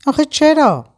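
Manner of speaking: high, loud pleading cry; desperate, breaking plea; anguished plea. Acted. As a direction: cry out the why in despair.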